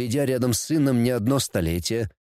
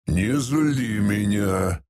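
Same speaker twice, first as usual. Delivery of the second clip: low, very slow menacing growl; 5 semitones lower, much slower than usual. A narrator's reading. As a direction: growl a warning, drawing it out. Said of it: "зарычал владыка". (угрожающе рыча, низко и медленно)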